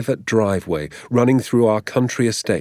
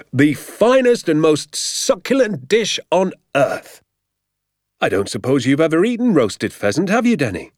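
a lightly voiced delighted father, a bit higher; gleeful dad counting his haul, slowed for effect; excited father; 6 semitones higher than usual. A narrator's reading. Father boasting to Danny about the pheasants, lightly voiced glee.

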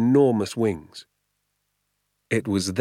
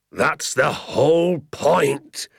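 slow, loud, higher exclamation; crying out; 7 semitones higher, 5 dB louder, much slower than usual. A narrator's reading. Hazel cries out at the sergeant, loud and slow. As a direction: stretch it out in outrage. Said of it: "cried Mister Hazel".

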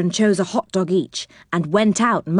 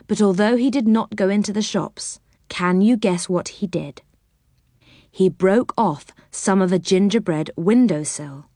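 ordinary delivery

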